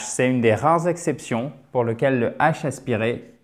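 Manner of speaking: ordinary delivery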